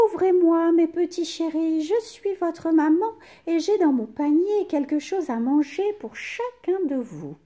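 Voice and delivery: wolf faking a soft high mother's voice. A narrator's reading. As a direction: sweet-talk them, pitched high. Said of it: "dit d'une voix douce".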